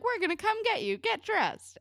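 bossy order. Retold or imitated. Friend tells whiny girl they're coming over, bossy. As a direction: order a reluctant friend up, pitched high.

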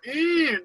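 high nasal-free "eh" revving up and cutting off; imitated engine rev. Neighbor's yard tool mocked as a high revving hum. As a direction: mimic the neighbor's machine revving. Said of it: "they go like this".

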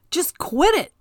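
retold yell. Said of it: "I yelled at the wind".

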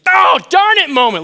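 loud, high cry of frustration; hammed-up outburst of dismay; mock exasperation. Preacher acting out a missed-it moment, mock exasperated.

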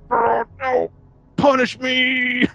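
high, drawn-out begging cry; hammed-up wail for punishment; mock anguished plea. Acts out guilt-ridden pilot begging.

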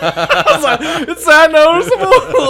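high, loud squeal through laughter; laughing mock panic. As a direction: crack up while imitating your own alarmed question. Retells own panicked reply while laughing.

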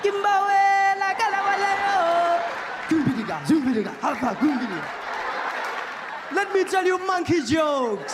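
very high, loud gibberish chant, mocking. Mocking what Americans imagine an African comedian sounds like, high and loud. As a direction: ham up the clichéd leopard-skin comic. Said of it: "people think a guy in leopard skin".

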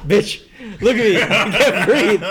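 loud, high plea through laughter; retold screaming. Man retelling his silent screams at his wife, loud and laughing. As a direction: imitate a frantic yell, laughing. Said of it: "you were screaming".

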